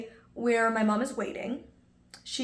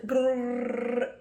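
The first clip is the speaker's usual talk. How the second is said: long rolled trill imitating rapid French; mock French gibberish. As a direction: stand in for foreign speech with a drawn-out "brrr". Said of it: "speaking a bunch of French".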